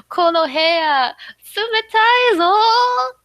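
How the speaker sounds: high, loud exclamation, drawn out; acted outburst, stretched and high; freaking out. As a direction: cry it out in a playful freak-out. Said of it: "she's freaking out".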